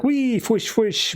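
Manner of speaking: very high playful whoosh sounds; little girl's whoosh noises. Retold or imitated. Girl playing Green Lantern with her toy, high playful whooshes. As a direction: squeal the zaps like a kid at play. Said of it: "making the flashy noises".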